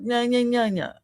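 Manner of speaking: high singsong nah-nah teasing; singsong taunt. Pretend. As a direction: tease it like a bratty child. Mock Trump refusing to go back.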